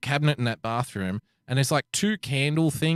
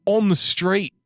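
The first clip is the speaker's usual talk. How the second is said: raised-voice mock calling out; retold yell; 3 semitones higher, 5 dB louder than usual. Storyteller correcting the man about where to park, retold yell. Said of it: "and I yell out".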